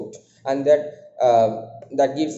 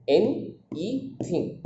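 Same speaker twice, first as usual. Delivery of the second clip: "N, E thing" broken into three slow, separate syllables; softly spaced-out "N, E thing", one beat per syllable; 3 semitones higher, 5 dB quieter, much slower than usual. Shown as a demonstration. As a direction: say "N, E thing" slowly with a gap after each syllable. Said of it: "These are the syllables".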